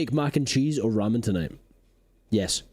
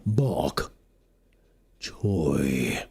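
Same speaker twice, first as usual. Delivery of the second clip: ominous whisper; 11 semitones lower, much slower than usual. Acted.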